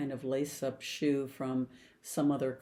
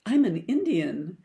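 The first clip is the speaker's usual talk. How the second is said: surprised; 4 semitones higher, 7 dB louder than usual. Retold or imitated. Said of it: "I'm really surprised".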